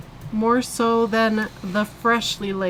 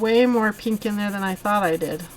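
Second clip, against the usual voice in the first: ordinary delivery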